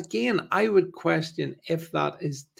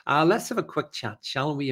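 normal talking voice

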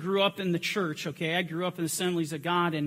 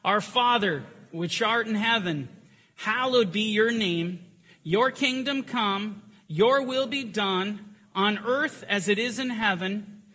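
slow, higher solemn recitation; leading the Lord's Prayer, slow and raised; 4 semitones higher, slower than usual. Real, not acted. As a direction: pray it aloud, measured and lifted. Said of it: "let us pray the prayer".

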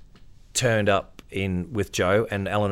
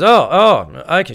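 surprised; 9 semitones higher, 11 dB louder, slower than usual. Retold or imitated. Wife's surprised reply.

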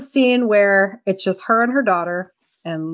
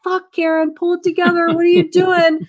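higher, exasperated mock telling-off; mock exasperated scolding; 9 semitones higher than usual. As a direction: snap at her to get it together, half joking. Scolding panicking character.

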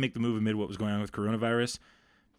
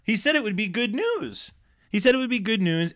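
exasperated rant; 10 semitones higher than usual. Real, not acted. Co-host ranting about a bad news tease, exasperated and high. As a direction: rant at the reporter's broken promise, pitched way up. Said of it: "I'm livid about this".

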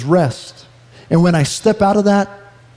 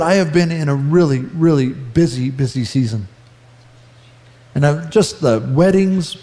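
ordinary talk, nothing marked

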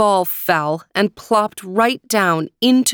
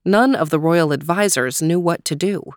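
usual speaking voice

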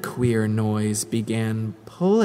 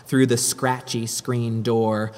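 usual speaking voice

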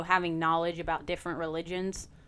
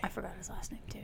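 whispering; 14 dB quieter, faster than usual. Real, not acted. Echoing the whispered aside.

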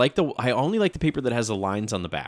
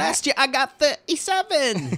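high, drawn-out mock-Cockney whine; bad Cockney accent; 13 semitones higher, slower than usual. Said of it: "Don't know what that accent was".

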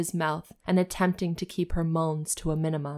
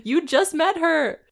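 higher, louder exclamation; incredulous; 10 semitones higher, 6 dB louder than usual. Real, not acted. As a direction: call out the absurdity. Mock outrage at character.

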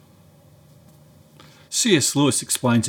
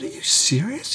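much higher, rising Are you serious; incredulous; 12 semitones higher, 4 dB quieter than usual. Retold or imitated. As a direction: ask it with wide-eyed disbelief. Disbelief at friend's mishap.

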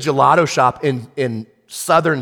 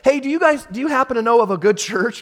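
wife's hopeful question; 9 semitones higher than usual. Retold or imitated. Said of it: "I hear her ask Jen".

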